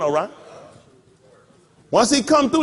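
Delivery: usual speaking voice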